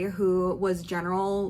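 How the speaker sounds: usual speaking voice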